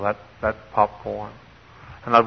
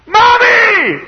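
very high, loud, drawn-out yell; lost child's scream; 12 dB louder than usual. Retold or imitated. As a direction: scream for mother as a lost kid. Child screams again for mother.

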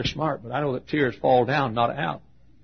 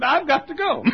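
hurried lady; 3 dB louder than usual. Retold or imitated. Pastor voices the hurried lady.

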